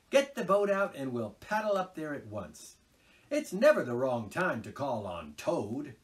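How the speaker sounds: eager water rat urging an outing; a hint of a brisk, friendly Rat; good-natured Rat. A narrator's reading. Rat voiced in the story.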